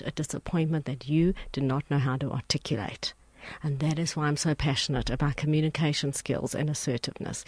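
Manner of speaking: whispered and intimate; confiding whisper. Real, not acted. Unvoiced confiding lines.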